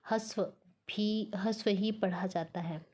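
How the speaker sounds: usual speaking voice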